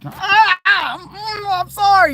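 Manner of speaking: high, loud yelp of pain then apology; pained cry. Retold or imitated. Knee pain hits him mid-apology, loud high yelp.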